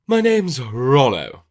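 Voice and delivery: loud, high, slow put-on toff voice; exaggerated drawn-out upper-class introduction; hammed-up upper-class character. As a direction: introduce yourself grandly as a class caricature, stretching the name. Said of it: "fitting for the class of the character".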